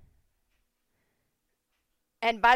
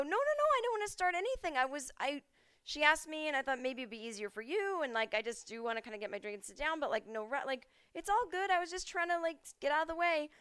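much higher anxious explaining; flustered backpedaling; 8 semitones higher than usual. Retold or imitated. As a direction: scramble to smooth it over. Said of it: "I go".

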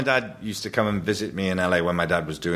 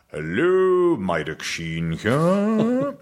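a higher, slow put-on posh phone greeting with a stretched hello; a Welsh dad going posh as his train nears London; Mike Sheen posh voice; 7 semitones higher, 3 dB louder, much slower than usual. Son rings dad on the train, dad answers in his higher, slow posh voice. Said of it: "he puts on a rather posh voice".

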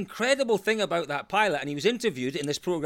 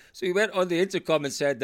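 ordinary talk, nothing marked

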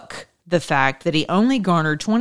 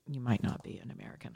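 muttered, quick jab; teasing aside; 4 semitones lower, 11 dB quieter than usual. Acted. Teasing viewers who voted twice for Obama, a mumbled joke. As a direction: drop the voice for a sly jab. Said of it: "I'm kidding".

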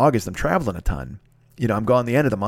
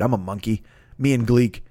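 usual speaking voice

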